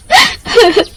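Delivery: high choked sob. Acted. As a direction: break into a sob. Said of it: "ऐसे क्यों रो रही हो". (ऊँची, घुटी सिसकी)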